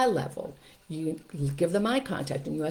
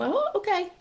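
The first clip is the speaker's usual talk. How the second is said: put-on much higher, a toddler's chirpy okay; little grandson. Grandson cheerfully agreeing to put shoes away. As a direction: squeak it like a three-year-old agreeing. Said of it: "And he'll".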